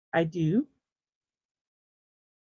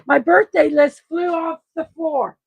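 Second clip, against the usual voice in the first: real raised exclamation, higher and louder; exclaiming; 7 semitones higher, 6 dB louder than usual. Real, not acted. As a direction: call it out in dismay. List blew off the floor.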